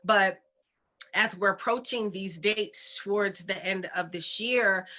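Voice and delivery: ordinary talk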